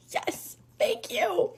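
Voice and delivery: high, drawn-out squeaky thanks; tearful gratitude. Retold or imitated. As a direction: act out overwhelmed relief. Acted tearful gratitude to boss.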